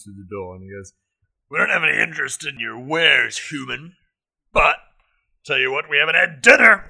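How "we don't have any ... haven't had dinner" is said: orc shout. Acted. GM voices second orc.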